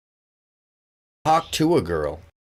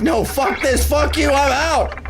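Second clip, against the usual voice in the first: fed-up cat; 10 semitones higher than usual. Retold or imitated. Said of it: "He's like".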